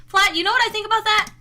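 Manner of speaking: ordinary delivery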